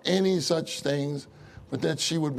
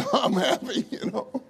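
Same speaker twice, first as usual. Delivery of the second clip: laughing through the words, pitched up; 5 semitones higher than usual. Retold or imitated. Preacher joking to his congregation, laughing. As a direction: burst into a laugh as you say it.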